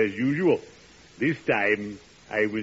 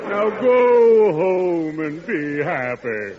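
slow, loud and high benediction; slow booming mock blessing; grand blessing; 5 semitones higher, 6 dB louder, slower than usual. Undertaker's grand farewell.

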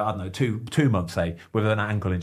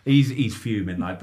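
usual speaking voice

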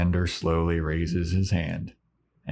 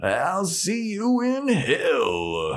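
slow, higher menacing drawl; slow demon farewell; 6 semitones higher, much slower than usual. Retold or imitated. Demon taunting the gang as he leaves, slow and mocking. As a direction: linger over the threat. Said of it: "He goes".

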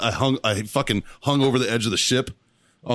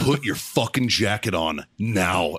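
retold order; 6 semitones lower, slower than usual.

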